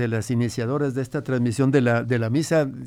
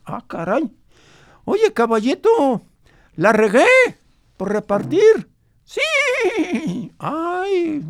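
lightly voiced grandfather, a bit higher; old grandfather; 9 semitones higher, 3 dB louder than usual. Grandfather recalls his ranch for the radio host, lightly voiced old man. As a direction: tell it as the old abuelo remembering his ranch.

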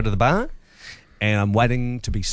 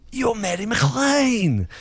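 a slow, awestruck fan naming her, a little higher; starstruck young fan; 9 semitones higher, slower than usual. Retold or imitated. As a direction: recognise her slowly, higher, like meeting a star. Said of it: "and he said".

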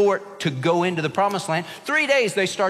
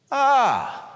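whiny groan; 8 semitones higher than usual. Retold or imitated. Kids groaning in car.

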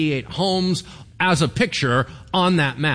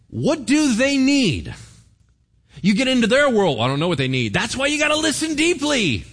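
fervent preaching; 9 semitones higher, 4 dB louder than usual. Acted. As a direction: drive it home to the congregation. Preaching at full force.

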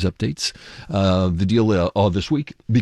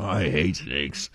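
understated mock yell, slow and softer; half-hearted movie hero impression, words stretched out; Indiana Jones; 6 dB quieter, much slower than usual. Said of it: "Harrison Ford just yells out as Indiana Jones".

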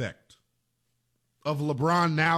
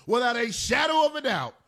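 ordinary talk

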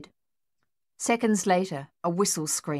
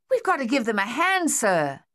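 higher, louder urgent yell; 5 semitones higher, 4 dB louder than usual. A narrator's reading. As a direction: shout it over the gunfire. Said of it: "Thaddeus yelled".

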